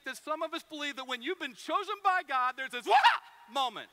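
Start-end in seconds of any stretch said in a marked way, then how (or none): 2.9-2.9 mock cry. Repeats the woman's cry.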